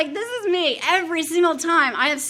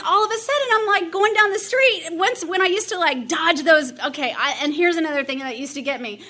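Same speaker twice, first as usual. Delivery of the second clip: ordinary talk, nothing marked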